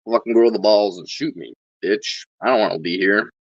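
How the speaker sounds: ordinary talk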